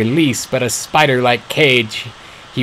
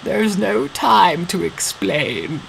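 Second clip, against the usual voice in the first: put-on old father voice; 8 semitones higher, much slower than usual. A narrator's reading. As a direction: urge her weakly. Weak father urges her.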